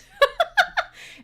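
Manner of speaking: high cackling laugh; cackle. Real, not acted. Streamer cackling at Mandy's chat.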